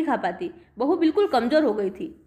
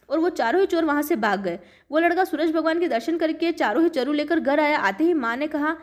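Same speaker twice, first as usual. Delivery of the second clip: ordinary delivery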